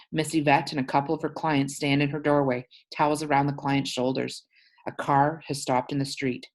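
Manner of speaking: usual speaking voice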